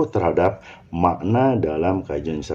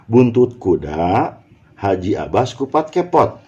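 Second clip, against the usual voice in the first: "buntut kuda" as one slow unit, then a break; group "buntut kuda", pause, then "haji, abas, kupat, kepot"; pause after kuda; slower than usual. Shown as a demonstration. Teacher showing students which words attach, pause after "kuda". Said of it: "buntut ini dengan kuda lekatnya".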